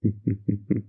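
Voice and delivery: brief, light chuckle; teasing chuckle. Acted. Boss joking around with doll, chuckling. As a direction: let out a little teasing laugh.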